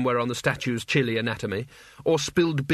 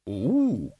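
ape hoot; 3 semitones lower than usual. Acted. Orangutan librarian hoots.

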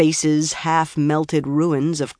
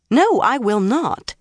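firm, higher-pitched, slow refusal; 7 semitones higher than usual. A narrator's reading. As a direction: refuse slowly and firmly. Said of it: "Egwene said firmly".

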